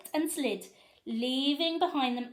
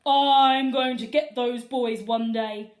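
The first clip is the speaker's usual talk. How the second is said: loud, slow, menacing vow; mean old principal; 2 semitones higher, 10 dB louder than usual. A narrator's reading. Principal vowing revenge on the boys, loud and slow. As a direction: growl it out like a spiteful principal. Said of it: "Mister Krupp vowed".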